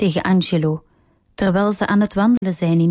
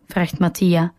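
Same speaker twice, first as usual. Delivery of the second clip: usual speaking voice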